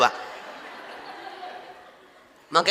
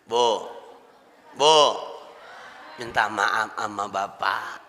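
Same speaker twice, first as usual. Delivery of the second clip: slow, soft and low, fully sincere; sincere apology; 9 semitones lower, 3 dB quieter, slower than usual. Shown as a demonstration. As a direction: ask forgiveness gently from the heart. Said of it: "Minta maafnya tulus, ikhlas dari hati". (minta maaf dengan tulus, pelan dan lembut)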